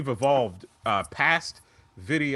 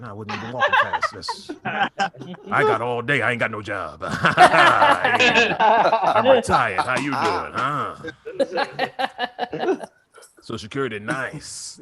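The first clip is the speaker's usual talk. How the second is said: cheery retiree. Retold or imitated. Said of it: "things that make you go".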